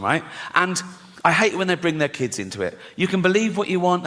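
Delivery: normal talking voice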